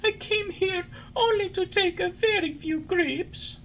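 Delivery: put-on high puppet boy voice, pleading; a small wooden boy begging to be freed; Pinocchio. A narrator's reading.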